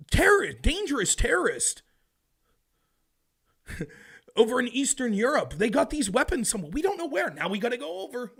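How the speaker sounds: high, loud sarcastic officialdom; mocking official warning. Mocking future officials announcing new war. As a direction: sneer it like a government spokesman. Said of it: "we'll be told".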